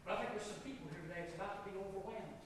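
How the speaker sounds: low, soft pastoral voice; quiet altar appeal. Real, not acted. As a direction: bring it right down and invite them gently.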